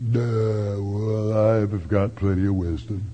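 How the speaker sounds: low, soft, dopey put-on voice; mock dullard. Preacher mocks a smug listener in a dopey voice. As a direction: play the smug fool who thinks he knows it all. Mocking a know-it-all who lacks wisdom.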